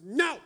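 put-on high, loud toddler wail of "No!"; toddler's No. Son refusing the yellow house, a loud high "No!". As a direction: belt "No!" like a stubborn three-year-old. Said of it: "you remember how he pronounces it".

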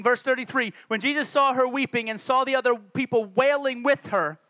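usual speaking voice

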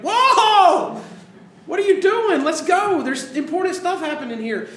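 high, loud, incredulous shout; shocked outrage. Retold or imitated. Caller watching police stop for car wash, outraged shout. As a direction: yell in disbelief at the police.

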